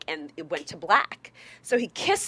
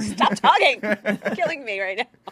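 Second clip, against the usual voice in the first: mock exasperated plea; 7 dB louder than usual. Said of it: "I was like".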